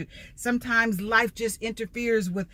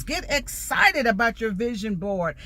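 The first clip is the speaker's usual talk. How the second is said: ordinary talk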